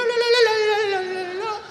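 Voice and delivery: high, singsong la-la mockery; mocking gibberish. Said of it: "My friend Jessica asked me".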